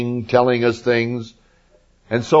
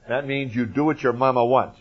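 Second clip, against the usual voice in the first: normal talking voice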